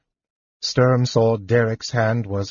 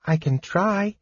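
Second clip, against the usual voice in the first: hedging; 9 semitones higher, 3 dB quieter than usual. Acted. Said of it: "Tas hedged".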